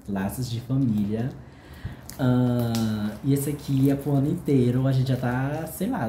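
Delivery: ordinary delivery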